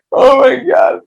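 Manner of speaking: high, loud, strangled oh my God; real cry of dismay. Real, not acted. Dreading telling the gun story, a loud pained cry. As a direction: cry out as if the memory hurts.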